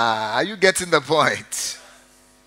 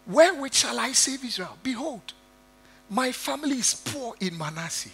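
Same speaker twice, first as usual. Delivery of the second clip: higher-pitched, softer pleading voice of Gideon; whining Gideon; 9 semitones higher, 5 dB quieter than usual. Acted. Preacher voicing Gideon protesting to the angel, higher and softer pleading. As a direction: plead like a small man who feels unworthy.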